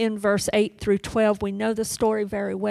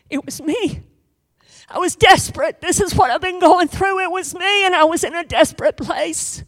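high, loud, desperate confession; desperate woman; 8 semitones higher, 4 dB louder than usual. Acted. Woman confessing to Jesus, high and desperate. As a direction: cry out your desperation. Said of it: "trembling and says".

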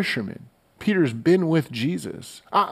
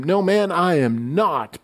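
retort; 6 semitones higher, slower than usual. Acted. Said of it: "Peter retorted".

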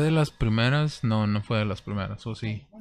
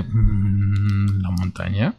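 puzzled inner voice; 3 semitones lower, much slower than usual. Retold or imitated. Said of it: "me quedé pensando así de que".

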